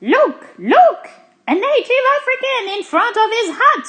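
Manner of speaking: high, loud, amazed outburst; staggered. A narrator's reading. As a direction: cry out in astonishment. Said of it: "says Zigomar, staggered".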